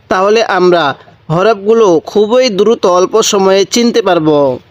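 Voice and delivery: ordinary delivery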